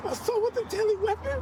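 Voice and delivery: shocked disbelief. Real, not acted. Hears charges read out.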